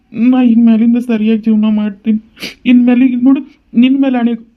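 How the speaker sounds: high-pitched, earnest begging; pleading. Acted. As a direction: son pleading with his weeping mother. Son begs crying mother, swears reform.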